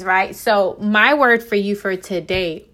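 normal talking voice

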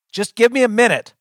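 loud, high, impatient snap; snapping. A narrator's reading. Grabbed narrator demands a minute, snapping. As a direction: snap at whoever is grabbing you. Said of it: "I snap".

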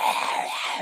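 rough breathy throat growl; zombie rasp. Shown as a demonstration. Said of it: "that zombie voice".

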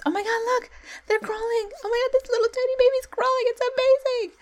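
very high, fast, cooing parent voice; gushing baby talk. Retold or imitated. Celebrating a baby learning to crawl, high gushing coo. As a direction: gush over the baby like a proud parent.

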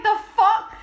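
high, loud retold disbelief; incredulous outburst. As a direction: blurt it out in mock outrage. Retelling own baffled reaction.

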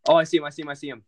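quick excited callout; hyped gamer bro. Real, not acted. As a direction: call out the enemy fast. Spotting an enemy.

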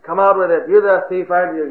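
rough accusation. Acted. Mob roughing up a suspect, mildly acted accusation. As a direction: press him to confess. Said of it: "they started roughing".

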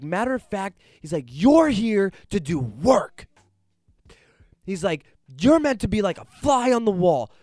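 high, loud stern telling-off; scolding. Retold or imitated. Friend scolds him loudly for oversharing. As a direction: lay into him hard.

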